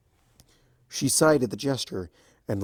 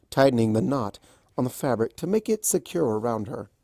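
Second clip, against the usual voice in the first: ordinary delivery, nothing marked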